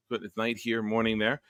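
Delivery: ordinary delivery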